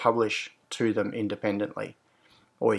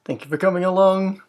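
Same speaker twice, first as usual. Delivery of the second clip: high, loud, lively stream welcome; animated, raised-pitch hello to friends; animated greeting; 8 semitones higher, 8 dB louder than usual. Real, not acted. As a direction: greet the audience with more pep than you feel. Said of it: "I think I sound far more animated".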